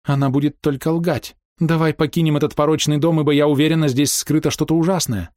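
high, anxious pleading. A narrator's reading. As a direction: urge your beloved to leave. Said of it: "взмолилась Ида". (умоляюще, тревожно)

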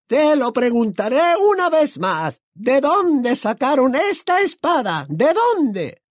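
very high, screeching interrogation; menacing high shriek; Bellatrix. A narrator's reading. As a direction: demand an answer in a shrill, drawn-out witch voice. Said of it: "la voz de Bellatrix".

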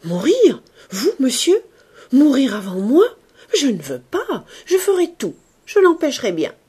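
loud, high, desperate protest; old maid crying out in protest at her master's bedside. Acted. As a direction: refuse his death with all her being. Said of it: "dans un cri de protestation".

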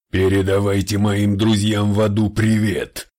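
loud, distorted demon-lord roar; demonic rumble. Acted. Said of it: "пророкотал тот".

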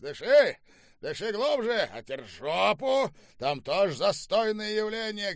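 high, energetic hectoring; jeering rant. A narrator's reading. General mocks both prisoners, high jeering rant. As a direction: gloat and scold at full pitch.

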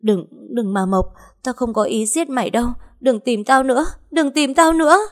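high, frantic pleading that climbs to a peak; panicked screaming. A narrator's reading. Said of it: "ôm đầu la hét".

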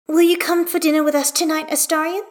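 put-on high little-girl voice, rising at the end; child asking. A narrator's reading. Hestia invites Astarion to dinner, high child's question. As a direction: ask carefully in a child's high pitch.

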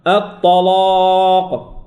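"الطلاق" slow, with dark L and long back A; heavy, thickened lam. Shown as a demonstration. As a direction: chant "الطلاق" with all letters thick. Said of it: "كل شيء مفخم".

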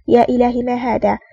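plain reading voice

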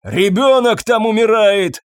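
loud, slow, high desperate cry; shouting. A narrator's reading. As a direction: yell urgently through a closed door. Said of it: "закричал в закрытую дверь".

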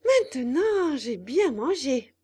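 rubbing a full belly; satisfied puppet. Acted.

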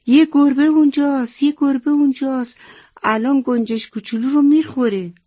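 high, loud, urgent cry of alarm. A narrator's reading. Grandmother spots the cat near the little sparrow, alarmed cry. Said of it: "فریاد زد".

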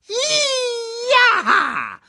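very high, drawn-out whooping yell; high-pitched cry. Acted. Meerkat charging at the vultures, high whooping cry. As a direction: let out a piercing high battle whoop. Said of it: "rief eine hohe Stimme".

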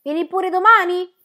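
high, loud call across the path. A narrator's reading. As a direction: shout it after her as he walks on. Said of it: "e gridò".

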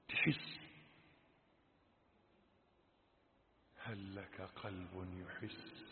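very soft, low preaching; hushed exhortation. Real, not acted. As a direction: speak quietly into the listener's heart, with long pauses. (وعظ خافت خاشع)

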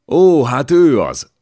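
put-on loud, high, drawn-out German-accented exclamation; German count's accented outburst, pitched high; foreign-accented German. A narrator's reading. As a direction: crow it slowly with a light German accent. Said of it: "recsent a német idegenes magyar kiejtéssel".

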